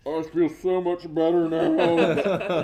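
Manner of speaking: slow and loud, laughing through the quote. Retold or imitated. Quoting drunk Kyle to friends, laughing. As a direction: quote him while cracking up. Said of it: "and he's like".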